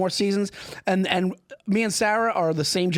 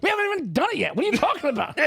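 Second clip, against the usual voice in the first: mocking co-host; 9 semitones higher, faster than usual. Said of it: "He goes".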